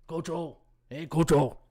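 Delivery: a hint of Coach O's voice; lightly voiced Cajun coach greeting; mild Coach O rasp; Coach O impression. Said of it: "I can't even do my Coach O".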